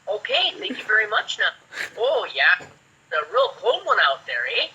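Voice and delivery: put-on Minnesota accent. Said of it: "They make it sound like we're some Northern Canadians".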